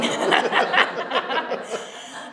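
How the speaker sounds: long breathy laugh. Real, not acted. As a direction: laugh at your own behavior. Laughs at her own retold remark.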